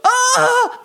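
sharp gasp and high "ah" cry; hammed-up gasp, then a mock cry of pain; heart-attack gasp. Host acts out Bruce's heart attack, mock agonized gasp. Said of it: "he goes down and he goes".

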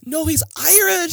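high, loud excited burst; fangirl excitement. Real, not acted. Fan correcting co-host about the actor, loud excitement.

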